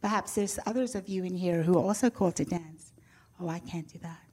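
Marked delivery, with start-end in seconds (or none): 3.4-4.2 hushed, self-doubting whisper; fearful whisper. Pretend. Pastor voicing timid churchgoers' doubts in a whisper. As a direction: whisper it like a shy person afraid to dance. Imagined timid worshipper's inner voice.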